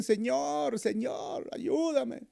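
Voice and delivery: mock panicked last-minute prayer; panicked plea. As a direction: cry out as if drowning in trouble. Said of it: "recién".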